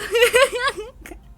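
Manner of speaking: high, loud burst of laughter; a real high giggle; embarrassed high laugh. Real, not acted. Embarrassed after French.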